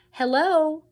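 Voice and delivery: merry princess call. A narrator's reading. Princess calling to Mig from her horse, high and merry. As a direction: sing out a bright hello across the field. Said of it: "called out merrily".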